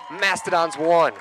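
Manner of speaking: ordinary delivery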